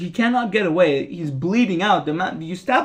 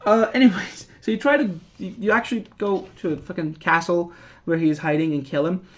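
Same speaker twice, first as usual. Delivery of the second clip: usual speaking voice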